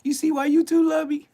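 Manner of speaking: high, gleeful question with laughter; amused laughing. Real, not acted. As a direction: laugh through it, pleased with yourself. Laughing at his own disclaimer.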